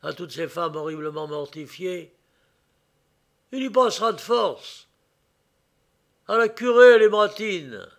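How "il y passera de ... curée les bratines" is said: shouted loud and high; angry boss. A narrator's reading. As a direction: yell the order at the pack. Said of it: "hurla le chef de la bande".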